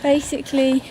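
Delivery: normal talking voice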